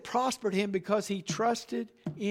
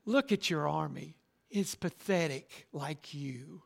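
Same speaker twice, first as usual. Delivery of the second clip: sneering taunt; 4 semitones lower, 5 dB quieter than usual. Retold or imitated. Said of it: "he's saying".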